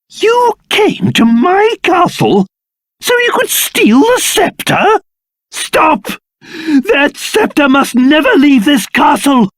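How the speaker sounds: high-pitched indignant accusation; outraged confrontation. Acted. King confronting the jester stealing the scepter, outraged. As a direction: forbid it with rising alarm.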